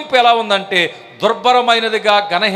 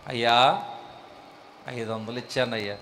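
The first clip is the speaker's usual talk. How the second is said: much softer, lower mumble of shame; sheepish admission; 7 semitones lower, 10 dB quieter than usual. Acted. Believer admitting a tiny offering to the pastor, sheepish and soft. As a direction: confess it almost under your breath.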